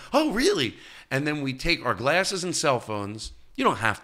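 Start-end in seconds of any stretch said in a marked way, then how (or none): 0.1-0.8 high incredulous rise; mock surprise. Realizing you need to pee mid-ritual, a high mock surprise. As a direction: act caught off guard. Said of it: "you're like".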